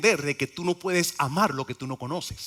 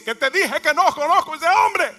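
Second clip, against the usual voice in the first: a much higher, loud, exasperated shout of denial; Peter's angry denial; 13 semitones higher, 9 dB louder than usual. Acted. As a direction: Peter snaps that he never knew the man.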